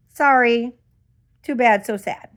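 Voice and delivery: loud, dismissive brush-off; punched sarcastic sorry; mock apology. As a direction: imitate an uncaring company, sarcastically. Mockingly voices the company's refusal.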